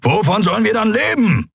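a character urging the captain in worry; heightened character voice. Acted. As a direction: push the captain with alarm.

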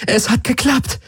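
high, rising cry of relief; joyful cheer. A narrator's reading. Crew after the plan works: high joyful cry. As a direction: burst out with the good news.

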